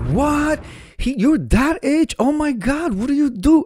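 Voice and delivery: high-pitched, drawn-out astonishment; imitating an amazed seamstress; shocked seamstress. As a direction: gush in disbelief, way up high.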